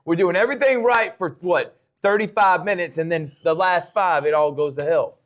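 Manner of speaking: urgent coaching plea. Retold or imitated. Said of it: "how do you get these guys that it's like".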